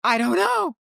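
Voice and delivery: high, mock-panicked protest; flustered denial. Retold answer to customs agent, afraid.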